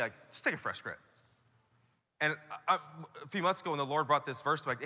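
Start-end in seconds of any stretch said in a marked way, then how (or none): 0.3-1.0 low, soft offhand order; insensitive command. Retold or imitated. Scripture as it sounds to a weary pastor, offhand. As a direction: toss it off without sympathy. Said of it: "this is what Hebrews twelve twelve sounds like to me".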